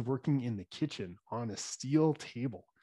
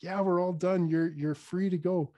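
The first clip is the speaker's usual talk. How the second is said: upbeat reassurance; 6 semitones higher, 4 dB louder than usual. Retold or imitated. Reassures girl treatment is over.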